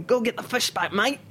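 a light Kiwi call across the kitchen; boss's Kiwi order. Retold or imitated. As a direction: chef barking an order in a mild Kiwi accent. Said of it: "couldn't understand the accent".

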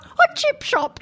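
fruity fake British accent, tossed in for fun. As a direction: ham up a posh British accent on the words. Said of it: "burst into a sort of fruity, fake British accent".

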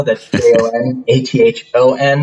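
J O N A T H O N said letter by letter, each one separate; spelling it out. Shown as a demonstration. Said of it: "My first name is spelled a little weird".

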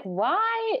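high-pitched, exasperated plea; exasperated why. Retold or imitated. Exasperated cry of complaint about pizza.

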